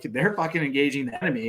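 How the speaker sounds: plain reading voice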